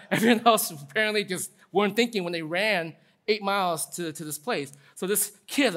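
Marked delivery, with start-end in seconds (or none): none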